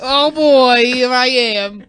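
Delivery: put-on character voice.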